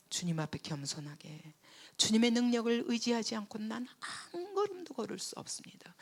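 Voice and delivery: ordinary talk, nothing marked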